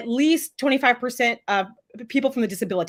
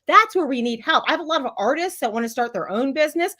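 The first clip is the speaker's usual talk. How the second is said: ordinary talk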